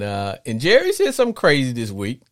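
ordinary delivery

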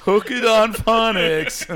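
high, loud, slow ad parody; mock ad voice. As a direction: ham it up like a TV jingle. Mocking Hooked on Phonics ad.

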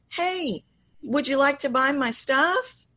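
"stuff" tilted up at the end in a hopeful lilt; rising lilt. Shown as a demonstration. Voice coach demonstrating an unsure sales lilt, rising on "stuff". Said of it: "A lilt is processed as".

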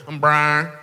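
twangy, drawn-together "Brian"; mock country twang introduction; Southern twang. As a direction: mimic a thick twang on "Brian". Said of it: "Okay, it's a twang".

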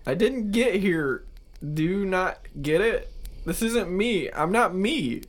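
lightly voiced panicked man; distressed cadaver. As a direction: beg to be believed.

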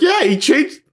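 high, laughing incredulous squeal; teasing a partner who skipped the menu, laughing high; laughing disbelief. Retold or imitated. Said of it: "I was like".

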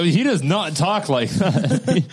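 laughing. Real, not acted. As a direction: crack up while protesting. Laughing at the mix-up.

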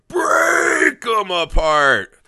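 drawn-out metal scream on the words; pro wrestling scream. Acted. Host opening the podcast, drawn-out mock wrestling scream. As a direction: scream it like a thrash-metal intro. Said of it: "you're gonna scream break".

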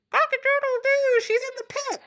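a very high, crowing tattletale rooster. Acted. Rooster telling the servants where the girl hides, crowing high. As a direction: crow it, then tattle.

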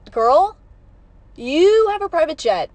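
loud, high mock outrage; incredulous scolding. Fan to singer about her private jet, loudly indignant.

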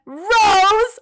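a high, loud yell of the name; retold yell. Donna yelling at the Doctor, a high loud shout. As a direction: shout Rose right in his face. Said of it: "she yells again".

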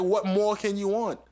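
whiny plea. Retold or imitated. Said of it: "I'm like".

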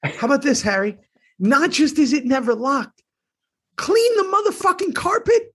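high, climbing yell at the mess; Salty Sal ranting. Pretend. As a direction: scold it like a furious neat freak. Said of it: "Sal and Harry are out".

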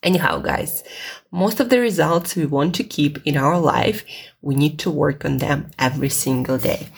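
ordinary talk

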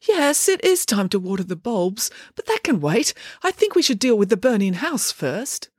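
mild flustered fretting; bewildered dad voice. A narrator's reading. Dad hesitating at the burning house, bewildered voice.

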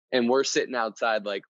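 usual speaking voice

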